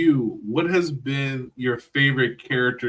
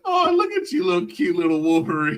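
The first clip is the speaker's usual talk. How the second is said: put-on teasing baby talk; 3 semitones higher, 6 dB louder than usual. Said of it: "You're like".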